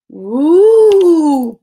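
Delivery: long loud ghost wail; ghostly ooh. Acted. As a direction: let the little ghost's ooh echo through the pines. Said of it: "Ben's voice bounced off the tall pines".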